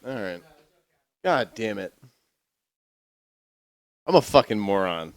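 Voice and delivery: ordinary talk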